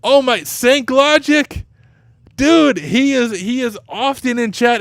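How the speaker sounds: high, slow delighted cry; thrilled to see his name; excited. Real, not acted. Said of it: "I'm so excited he won".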